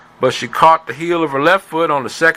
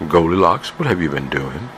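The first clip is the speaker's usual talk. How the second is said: low, quieter scolding mother; stern mama voice; 7 semitones lower, 4 dB quieter than usual. Acted. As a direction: scold like a suspicious mom. Mother scolds in told story.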